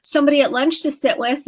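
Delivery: rescuing parent. Retold or imitated. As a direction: parent rushing to fix the crying child. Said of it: "they go".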